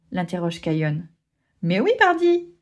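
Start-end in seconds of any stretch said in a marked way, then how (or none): 1.6-2.5 cocky answer. Acted. Bunga proudly confirming.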